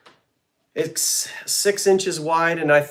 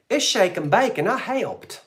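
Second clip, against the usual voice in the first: drawled Southern "It's shake and bake, and I helped" with "helped" stretched to "haylped"; Southern commercial accent; 7 semitones higher than usual. Retold or imitated. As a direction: imitate the old TV ad girl's Southern twang. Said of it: "I didn't say that with the right accent".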